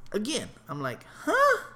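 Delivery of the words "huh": a sudden squeaky huh; startled, confused yelp; high puzzled "huh". Retold or imitated. Confused by the lady's pointing, a high startled huh.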